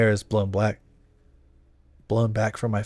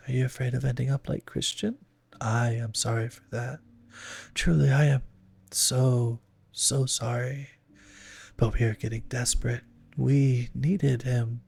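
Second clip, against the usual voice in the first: demon voice; 3 semitones higher than usual. A narrator's reading. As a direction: speak as a coaxing ghostly woman. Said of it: "comes the voice of the demon".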